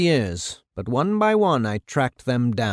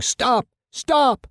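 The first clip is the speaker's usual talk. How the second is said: high, urgent call; congressional-sounding yell; 12 semitones higher than usual. A narrator's reading. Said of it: "a booming congressional-sounding voice yelling".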